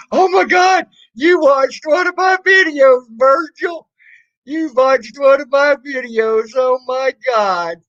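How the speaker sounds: high, loud mock excitement; sarcastic awe. Streamer mocking a troll who watched his videos, fake-thrilled shout. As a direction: gush over him sarcastically, shouting.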